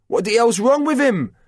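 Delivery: high, loud shout over gunfire; shouted question. A narrator's reading. John shouting about the frozen soldier, loud shout. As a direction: yell it across the firing line. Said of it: "shouted John".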